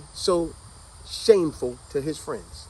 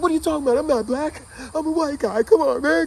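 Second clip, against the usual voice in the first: frantic protest; 10 semitones higher, 4 dB louder than usual. Retold or imitated. Protesting to the Klansmen.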